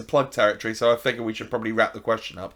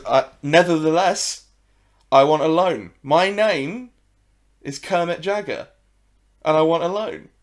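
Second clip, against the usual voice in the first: higher, louder, slow cartoon frog; 6 semitones higher, 8 dB louder, slower than usual. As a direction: puff up like a pompous frog demanding a loan. Frog character in a joke.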